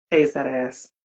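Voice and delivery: low, dry catchphrase; deadpan punchline. Acted. Advising ladies how to keep a man, low deadpan punchline. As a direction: drop it low and flat as the kicker.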